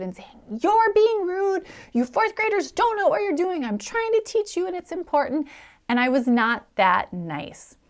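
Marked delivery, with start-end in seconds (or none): 0.6-5.5 retold yell. A kids' pastor yelling at rude fourth graders, loud scolding shout. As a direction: yell it out like losing your temper with rowdy kids. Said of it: "yelling at them as loud as I could".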